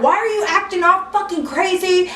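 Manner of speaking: high, heated scolding; acted angry tirade; cussing her out. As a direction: imitate a fed-up boyfriend going off. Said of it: "started cussing her out".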